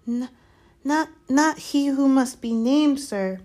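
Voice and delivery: high, slow elf voice; hesitant house-elf. Acted. Dobby hinting to Harry who it isn't, hesitant. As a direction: stammer it out as a nervous elf.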